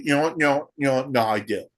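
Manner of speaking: normal talking voice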